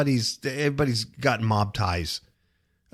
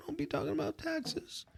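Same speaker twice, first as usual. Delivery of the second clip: mock whisper; 14 semitones higher, 13 dB quieter than usual. Teasing warning off a topic.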